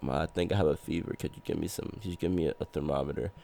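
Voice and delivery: low, soft, feeble request; weak sick plea. Retold or imitated. Asking mom for a thermometer at night, weakly. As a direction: imitate asking softly while feverish.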